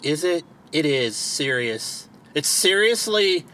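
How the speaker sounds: ordinary talk, nothing marked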